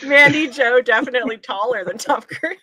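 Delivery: words through real laughter; laughing. Real, not acted. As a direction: laugh while saying it. Laughing over poster joke.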